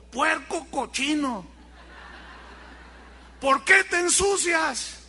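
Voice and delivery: scolding the pig. Retold or imitated. José scolding his muddy piglet, slow exasperated reproach. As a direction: scold the dirty piglet like a fed-up owner. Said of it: "lo regañó y le dijo".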